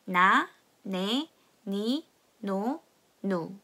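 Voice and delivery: 나, 네, 니, 노 each drawn out slowly; slow it down for the class to follow; slow read-along. Shown as a demonstration. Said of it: "다 같이 한번 읽어보겠습니다". (천천히 한 음절씩 따라 읽기)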